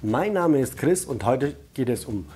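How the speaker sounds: usual speaking voice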